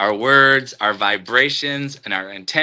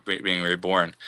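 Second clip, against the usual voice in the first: ordinary talk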